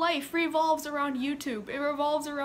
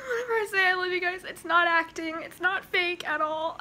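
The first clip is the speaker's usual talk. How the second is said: ordinary delivery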